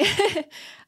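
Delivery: high flustered giggle; embarrassed laugh. Real, not acted. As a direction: laugh at your own slip. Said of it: "having a moment".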